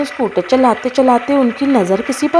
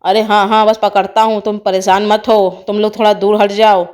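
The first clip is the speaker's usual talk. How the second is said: low, put-on man's voice; deep-voiced charmer taking charge; reassuring snake charmer; 5 semitones lower than usual. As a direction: tell them not to worry, in deep character.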